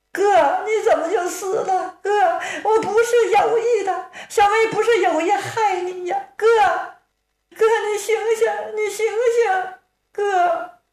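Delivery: high, tearful wailing over a dead brother; hammed-up lament in a high girl's voice; wailing sister. Sister mourning her dead brother, high wailing cry. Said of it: "姑娘是大放悲声".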